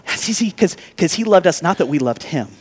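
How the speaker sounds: ordinary talk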